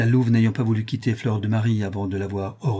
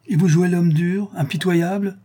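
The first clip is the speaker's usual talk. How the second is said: high, raised voice of warm protest; exclaiming; 8 semitones higher, 5 dB louder than usual. A narrator's reading. The count answers the doctor's generous offer, exclaiming. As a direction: exclaim it in lifted disbelief. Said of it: "s'écria le comte".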